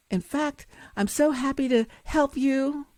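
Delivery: high, bright sing-song of a false friend; fake cheerful helper. Fake person sweetly offering the rope, high fake cheer. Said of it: "the fake person says".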